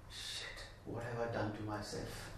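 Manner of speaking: hushed, horrified whisper to himself; whispered dismay. Retold or imitated. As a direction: breathe it out in shock. Retold moment of self-reproach.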